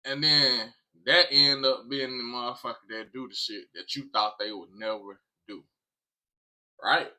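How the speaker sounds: normal talking voice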